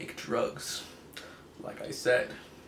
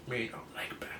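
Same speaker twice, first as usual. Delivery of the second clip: whispered Hulk grumble; softly whispered monster line; 8 dB quieter than usual. Retold or imitated. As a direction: hush it like a sulking brute. Hulk voiced, muttering.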